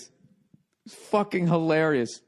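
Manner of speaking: ordinary talk, nothing marked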